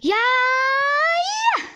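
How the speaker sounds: high, loud crying yell; anguished shout. Real, not acted. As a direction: wail in despair. Desperate moment in game.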